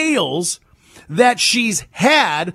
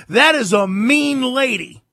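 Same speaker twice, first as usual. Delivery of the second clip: ordinary talk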